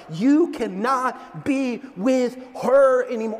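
slow, word-by-word plea to a friend; emphatic warning. Acted. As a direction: hammer each word in mock intervention.

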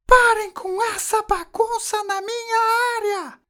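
very high, slow, loud shriek; Dona Florinda screaming. A narrator's reading. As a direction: screech the order like an alarm. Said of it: "ela continuou".

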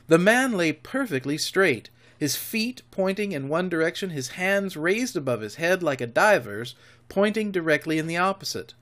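ordinary delivery

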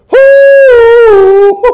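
strained high whoop from the effort; loud high whoop. Real, not acted. Cheering while holding a plank.

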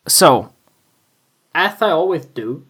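normal talking voice

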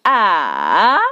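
"ah" dipping low, then rising; third tone. Shown as a demonstration. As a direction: drop "ah" low and swing it back up. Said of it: "and put a third".

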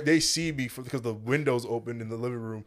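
usual speaking voice

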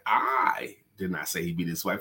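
"I" stressed with a lift, the rest of the sentence even. Shown as a demonstration. Teaching voice inflection to persuade, stress on "I". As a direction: lean on "I" as the one who didn't say it. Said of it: "That means me in specific".

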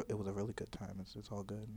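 very soft, low murmur; playfully hushed reassurance; mock ASMR. Slipping back into mock ASMR.

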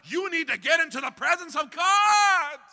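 loud, high-pitched proclamation; preaching shout. Retold or imitated. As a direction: belt out the advice like a preacher. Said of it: "and trouble, tell".

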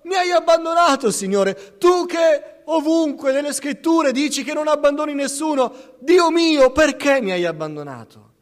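high, loud cry of abandonment; anguished cry. Acted. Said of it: "Gridò".

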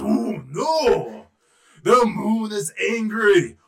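loud, drawn-out elephant dismay; big elephant voice, mock horror; alarmed elephant king. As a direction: boom it out in slow, dawning alarm. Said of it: "the king of the elephants saw".

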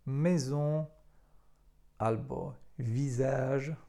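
"maison" and "visage" with S as Z, "visage" slowly; teacher showing S between vowels; French pronunciation. Shown as a demonstration. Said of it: "tak jak w słowach".

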